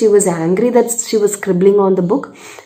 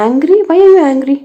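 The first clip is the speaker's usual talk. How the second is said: higher, louder startled asking; surprised question; 7 semitones higher, 5 dB louder than usual. Retold or imitated. Teacher asking a six-year-old why she is angry, surprised and louder. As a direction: sound taken aback by the child's answer.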